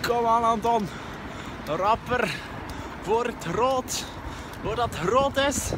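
high, loud self-urging. Real, not acted. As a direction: push yourself to beat the red. Urging himself through the light.

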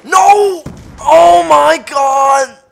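loud, high, drawn-out shout of despair; stretched-out anguished yell; frustrated yell. Real, not acted. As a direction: scream it at the screen. Losing a match, yelling.

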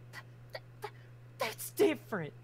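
stuttering, then pops up high; flustered stammer. Acted. Crimson caught naming her hoe, flustered stammer. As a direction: trip over the start, caught out.

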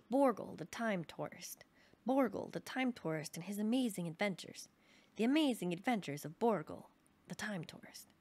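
mock adventure-serial title announcement; grand, swinging announcer delivery; TV show announcer. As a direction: announce a thrilling episode, punching the title.

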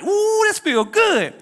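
high, slow delighted ooh; drawn-out relish of the crowd's cheers; savoring the cheers. Retold or imitated. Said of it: "And I'm like".

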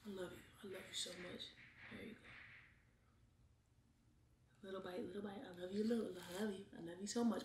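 very soft, low and tender; tender murmur. Real, not acted. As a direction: murmur it softly while feeding her. Feeding partner a bite.

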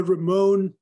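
"Ramón" as loud American ruh-MOHN; anglicized pronunciation. Shown as a demonstration. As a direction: say "Ramón" with English r and gliding o. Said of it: "no Ramón".

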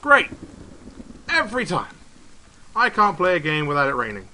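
sarcastic, exasperated. Real, not acted. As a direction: groan about the rain. Annoyed at rain again.

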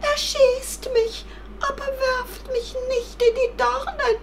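high, squeaky tearful rabbit; wailing plea. Acted. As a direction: plead in a very high whine. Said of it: "jammerte das Kaninchen". (jammernd flehend)

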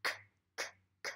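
soft unvoiced k, k, k like a scratching cat; whispered k sounds. Shown as a demonstration. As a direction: breathe out the k sounds like claws scratching. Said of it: "Make your hands like claws and scratch".

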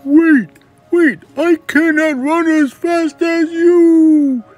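loud, very high, drawn-out call; frantic storybook frog, stretched words; frog calling after. Acted. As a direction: yell desperately after someone running away. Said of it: "The frog croaked after her".

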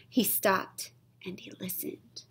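spooky hushed whisper; breathy storytime whisper. Acted. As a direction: whisper it like a secret in the dark. Narrator hushes the suspense.

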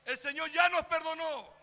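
loud, fervent preaching that lifts the crowd; preaching at full force. Acted. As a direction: proclaim it to the congregation. Predicación a plena fuerza. (predicación fervorosa y fuerte)

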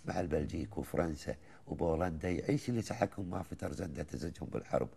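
chanted Gulf verse, rising and falling lines; poem recitation. Acted. Host listens as he recites the old poem, measured and rhythmic. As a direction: recite it like a folk poet to the host.

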